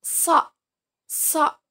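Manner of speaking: crisp demonstration of a heavy "So"; emphatic Arabic shad. Shown as a demonstration. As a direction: punch out a short, heavy "So". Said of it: "pangkal lidah mengangkat ke atas".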